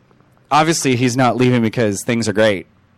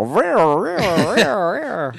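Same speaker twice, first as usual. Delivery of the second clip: higher teasing rear rear; mock rear sound; 8 semitones higher, much slower than usual. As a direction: chant it like a silly noise. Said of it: "make people wanna go".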